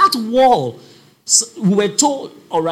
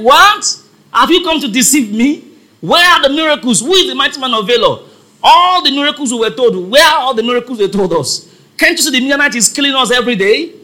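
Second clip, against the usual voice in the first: higher, louder acted protest; indignant challenge; 4 semitones higher, 4 dB louder, faster than usual. Gideon confronting the angel over missing miracles, indignant challenge. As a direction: throw incredulous questions back in complaint.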